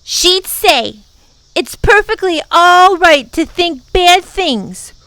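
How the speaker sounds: high, loud outburst; indignant little girl. A narrator's reading. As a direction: tell them off, high and loud. Girl quotes her mommy indignantly.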